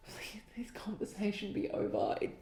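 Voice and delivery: breathy whispered begging; whispered plea. Real, not acted. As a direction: whisper it like a desperate prayer. Pleading whisper, exasperated.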